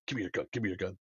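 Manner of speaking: whisper-like order to a teammate; hushed demand. Retold or imitated. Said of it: "and I was like".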